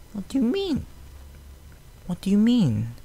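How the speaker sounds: high, soft puzzled pony; high Fluttershy voice. A narrator's reading. Fluttershy asking the fillies, high and puzzled. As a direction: ask it gently, high and unsure.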